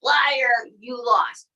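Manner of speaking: ordinary talk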